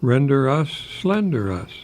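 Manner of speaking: slow, savoured "slender us" with the rhyme leaned on; rhyme stressed. Shown as a demonstration. Radio host recites his little rhyme, drawing it out slowly. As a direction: let "Render us, slender us" ring as a rhyme. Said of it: "but they rhyme".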